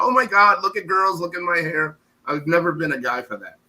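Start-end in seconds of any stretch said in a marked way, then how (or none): 0.0-2.0 higher-pitched mock showing off; mock attention seeker. Man mocking women who want their hair noticed, mock excited. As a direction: imitate someone fishing for looks, pitch up. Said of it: "They're like".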